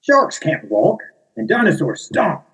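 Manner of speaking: high, indignant character voice; a picky character corrects the narrator; character objecting. Acted. Shark corrects narrator, high indignant character voice.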